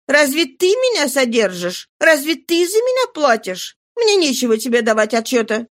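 fed-up, challenging character voice; Nana, impatient. Acted. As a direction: throw it at him, irritated and defiant.

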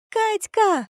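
shrieking with joy, very high; shrieking jackpot winner. Acted. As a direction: squeal her name in delight. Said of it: "Милена завизжала от радости".

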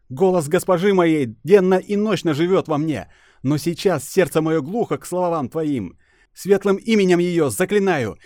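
defiant priestess. Acted.

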